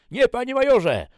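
very high, very loud; shouted military reply. Acted. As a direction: sound off to the major. Rebuked soldier answers the major. (wykrzyczana żołnierska odpowiedź)

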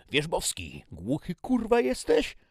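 exasperated commander, shouting high; angry roar. A narrator's reading. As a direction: roar at a soldier who ignores you. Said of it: "ryknął dowódca, wyprowadzony z równowagi". (wściekły ryk dowódcy)

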